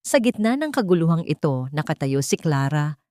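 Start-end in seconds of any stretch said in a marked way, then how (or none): none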